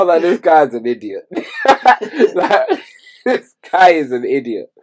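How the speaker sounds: laughing through the words; giggling while calling him an idiot. Real, not acted. Cracking up at rapper's bar.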